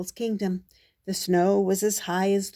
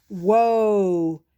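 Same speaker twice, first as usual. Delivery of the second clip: louder drawn-out wonder; amazed whoa; 7 dB louder than usual. Acted. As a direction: marvel at the crowd. Amazed at more heroes.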